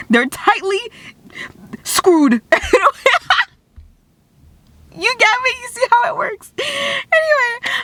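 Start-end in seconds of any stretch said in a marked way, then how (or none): 4.9-7.7 much higher, playful squeaky voice; goofy high voice. Acted. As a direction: squeak it out like a joke. Goofy high-pitched bit.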